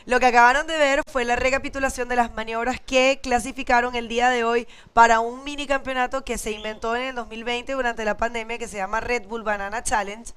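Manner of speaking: ordinary talk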